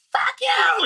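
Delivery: high, loud squawk of a cussing lady; mock cussing lady. Imitating the lady cussing out a cashier, shrill mock yell. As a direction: fling it out like the angry lady at the register. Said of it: "one lady who just will cuss you out".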